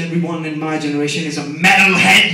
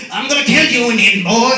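loud, higher mock villain threat; 4 semitones higher, 8 dB louder than usual. Attacker threatening the hero with a knife, loud mock threat. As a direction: yell it like a cartoon attacker. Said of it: "he's coming like".

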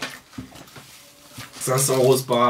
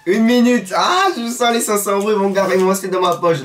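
excited; 6 semitones higher, 3 dB louder than usual. Real, not acted. Winning bet, gloating at friend.

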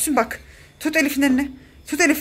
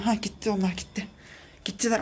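normal talking voice

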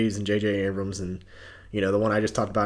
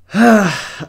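loud, exasperated sigh; heavy sigh; 10 semitones higher, 11 dB louder than usual. Real, not acted. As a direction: let out a big audible sigh. Reacting to the show's art.

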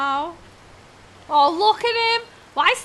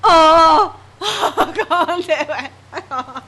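shaky laugh near tears; laughing-crying; 8 dB louder, much slower than usual. Real, not acted. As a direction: laugh through the fright. Breaks down after scare.

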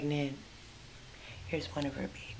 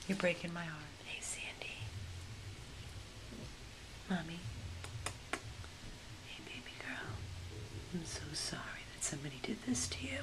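soft, hushed apology; tender whisper; 9 dB quieter than usual. Real, not acted. Rescuer whispering sorrow to the abandoned mother dog. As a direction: whisper softly, heartbroken.

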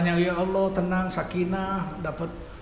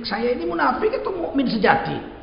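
higher, lightly voiced soul-searching question; self-questioning worshipper; 8 semitones higher, 4 dB louder than usual. Shown as a demonstration. Preacher voicing a believer who questions his own faith, lightly raised. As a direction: ask yourself this, searching your own heart. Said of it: "boleh menintrospeksi diri kita".

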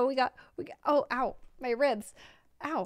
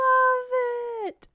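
much higher, tender gush; gushing delight; 11 semitones higher, 4 dB louder than usual. Real, not acted. Streamer thanking friends, gushing with joy. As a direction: pour out the love for it. Overwhelmed by gift.

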